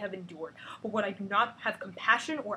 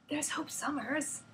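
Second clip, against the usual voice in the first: character voice; 6 semitones higher, 5 dB quieter, slower than usual. Acted.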